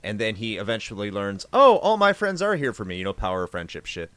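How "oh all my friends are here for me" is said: sappy high mock of a sudden realization; higher, louder gushing mock voice; mock Cloud epiphany. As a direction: ham up his sentimental epiphany.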